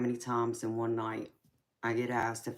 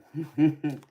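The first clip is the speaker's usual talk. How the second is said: quick louder laugh; real short laugh; 4 semitones higher, 6 dB louder than usual. Real, not acted. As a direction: burst out laughing briefly. Laughing at viewer comment.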